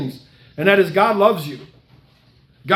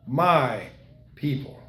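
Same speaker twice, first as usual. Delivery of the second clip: mild grieving God; 4 semitones lower, 5 dB quieter, much slower than usual. Acted. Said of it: "tears streaming down his face saying".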